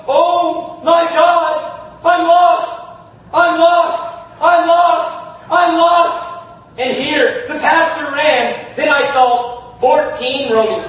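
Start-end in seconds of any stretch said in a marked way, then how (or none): 0.1-6.3 sobbing cry. Retold or imitated. Poor pastor sobbing in church, anguished cry. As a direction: plead it out, breaking with grief. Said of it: "heard somebody else sobbing".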